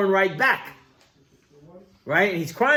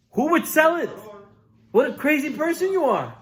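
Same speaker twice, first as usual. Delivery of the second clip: incredulous scolding; 11 semitones higher, 3 dB louder than usual. Acted. Wife scolds husband in disbelief.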